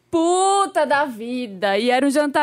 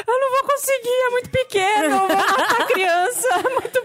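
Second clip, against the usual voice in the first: high, tearful, half-laughing wail; retold crying panic; 11 semitones higher than usual. Mother-to-be retelling her fear of the newborn, in a tearful high wail. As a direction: replay the panic of crying over a tiny newborn. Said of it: "eu comecei a chorar porque eu falei".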